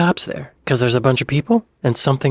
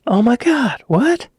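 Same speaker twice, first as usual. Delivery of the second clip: surprised; 12 semitones higher, 4 dB louder, slower than usual. Real, not acted. Delighted by the Liverpool boycott.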